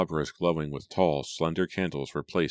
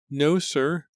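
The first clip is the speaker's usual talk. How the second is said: put-on high, timid servant girl; meek maid voice; 12 semitones higher, 4 dB louder than usual. A narrator's reading. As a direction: answer shyly, eager to please. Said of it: "replied meekly".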